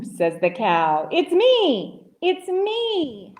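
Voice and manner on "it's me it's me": high, happy reveal; gleeful cow. A narrator's reading. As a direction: sing out the surprise in a high voice. Voices cow revealing itself.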